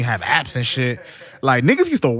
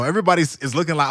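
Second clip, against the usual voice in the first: ordinary talk, nothing marked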